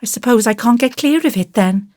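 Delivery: put-on mildly resigned little boy; resigned sigh. A narrator's reading. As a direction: give in like a disappointed small boy. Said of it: "said Davy with a sigh".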